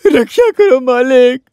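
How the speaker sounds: slow, drawn-out theatrical plea; pleading. Acted. Servant begging master to save his life, hammed-up pleading. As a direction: wail for help, stretching the words.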